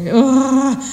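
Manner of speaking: loud mock groan of annoyance; imitated drawn-out "ugh"; exasperated parental groan. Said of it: "our parents would just go".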